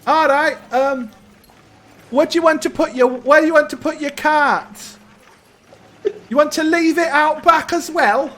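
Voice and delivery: put-on high-pitched boy's voice; young stable boy. Stable boy asking guests about their cart, high boyish voice. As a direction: pitch it well up like an eager-to-serve lad.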